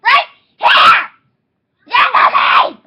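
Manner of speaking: high, loud angry orders; frustrated yelling. Real, not acted. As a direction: yell at your sister to go. Screaming at sibling in play.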